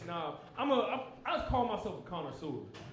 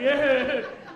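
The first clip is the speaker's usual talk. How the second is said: loud laugh; 5 semitones higher, 8 dB louder than usual. Real, not acted. Comic laughs at his own story, loud burst.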